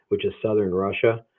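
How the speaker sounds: ordinary delivery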